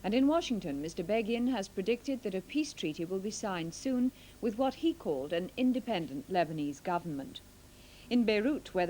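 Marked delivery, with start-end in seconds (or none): none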